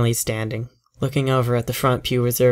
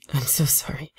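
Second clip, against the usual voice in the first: whisper; 2 semitones higher, 5 dB quieter than usual. Acted. Text read softly, apologetic.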